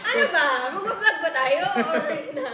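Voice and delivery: loud, high mock exasperation; mock annoyed outburst. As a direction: playfully snap at your partner for laughing. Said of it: "Galit na".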